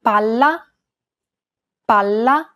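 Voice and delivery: "Palla" with the L held an extra syllable; held double consonant. Shown as a demonstration. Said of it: "holding that consonant sound for one extra syllable".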